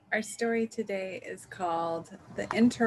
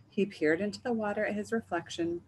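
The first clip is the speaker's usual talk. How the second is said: ordinary delivery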